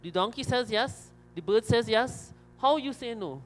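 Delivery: high-pitched agitated protest; indignant storybook crocodile, worked up. Acted. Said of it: "crocodile now getting very agitated".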